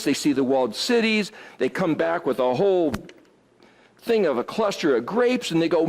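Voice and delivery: ordinary talk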